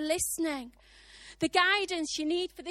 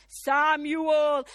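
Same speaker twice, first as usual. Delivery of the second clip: loud, drawn-out call of a name; mysterious voice summoning, raised and sustained; 9 dB louder than usual. Acted. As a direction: call out to a sleeper. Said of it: "he hears this voice".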